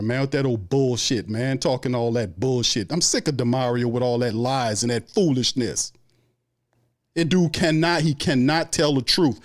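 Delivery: ordinary delivery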